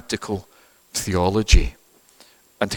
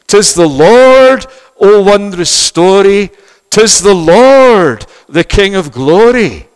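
slow, loud, high triumphant proclamation; proclaimed hymn; 9 semitones higher, 10 dB louder, slower than usual. Acted. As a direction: ring out the Lord's name in triumph.

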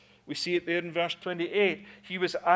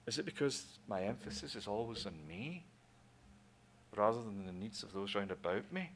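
quiet self-question; 8 semitones lower, 11 dB quieter than usual. Real, not acted.

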